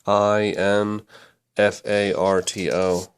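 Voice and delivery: I N F A R T O, each letter said slowly and apart; spelled out letter by letter. Shown as a demonstration. Teacher spelling infarto for students, slow letters. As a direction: spell the word out slowly for the class. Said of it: "zoom in on that one word".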